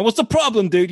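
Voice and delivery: mock New York directness; New York accent. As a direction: put on a blunt New Yorker voice. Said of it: "New York here".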